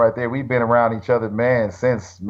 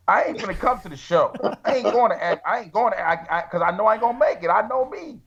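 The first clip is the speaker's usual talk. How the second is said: laughing refusal; 6 semitones higher than usual. Retold or imitated. Refusing Chris's tour, laughing at himself.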